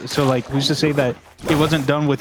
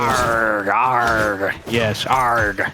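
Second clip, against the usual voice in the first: pirate voice; 4 semitones lower, much slower than usual. Acted. Pirate talk about pirating.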